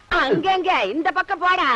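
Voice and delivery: loud, high scolding order; angry shout. Acted. Woman ordering the man to clear off, angrily shouted. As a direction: shoo him off loudly.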